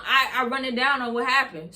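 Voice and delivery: usual speaking voice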